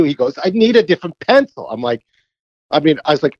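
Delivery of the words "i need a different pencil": fussy golfer voice pitched well up; needy golfer. Retold or imitated. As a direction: whine it like a picky player. Caddy voices needy golfer's complaint.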